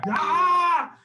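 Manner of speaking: loud, high-pitched scream; angry scream. Acted. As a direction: let out a furious shriek.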